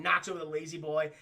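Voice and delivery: ordinary talk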